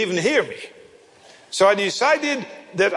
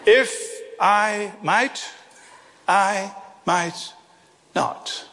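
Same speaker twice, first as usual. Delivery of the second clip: slow and deliberate; much slower than usual. Shown as a demonstration. Preacher drives the boy's lesson home, slow and deliberate. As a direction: space out "If... I might... I might... not".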